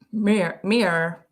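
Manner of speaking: "mirror" cut to one syllable, "meer"; imitated American pronunciation. Streamer trying the easier American way, one-syllable meer. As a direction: repeat "mirror" as meer, second syllable dropped. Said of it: "That's even easier than mirror".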